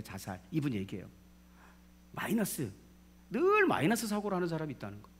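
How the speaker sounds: usual speaking voice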